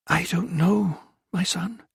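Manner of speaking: soft, high, fading through tears; tearful. A narrator's reading. Crying mother answers her small son, soft and tearful. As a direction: choke it out gently. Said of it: "the tears streaming".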